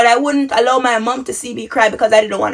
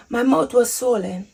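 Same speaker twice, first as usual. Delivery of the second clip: hushed repeat; 7 dB quieter than usual. Real, not acted. Telling viewers about waking up swollen, hushed repeat. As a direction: let it sink in, softly. Repeats the reveal much softer.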